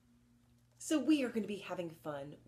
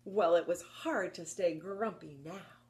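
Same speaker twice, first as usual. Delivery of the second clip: ordinary delivery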